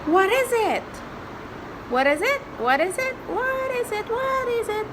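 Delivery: high singsong questions; playful quizzing. Acted. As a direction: tease the answer out. Quizzing child, rising pitch.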